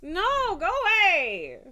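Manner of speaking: high, loud shooing; shooing cry. Real, not acted. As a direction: shoo the cat off sharply. Shooing the cat.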